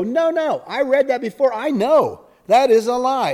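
high, loud indignant objection; indignant protest. Retold or imitated. Reader catching a rewritten text, high indignant protest. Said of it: "you'd go".

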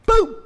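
high, loud explosive burst; exclaimed boom. Acted. Devil promising Eve an instant change, explosive exclamation. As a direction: pop it out suddenly. Devil acts out sudden transformation.

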